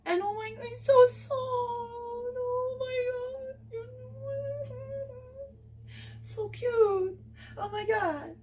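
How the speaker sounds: soft, high adoring coo. Real, not acted. Gushing over the soft figure, high and cooing.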